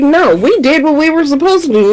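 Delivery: high-pitched mimicked excuse, rushed along; whiny-pitched retort, played for mockery; mock defensive protest. Retelling the queens' defensive excuse.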